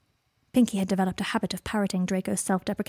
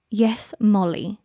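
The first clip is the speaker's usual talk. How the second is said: sarcastic drawl; 5 dB louder, much slower than usual. Acted. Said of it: "he said".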